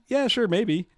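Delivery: high, breezy yeah sure maybe; noncommittal shrug voice. Retold or imitated. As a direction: toss it off like you'll never show. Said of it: "He says".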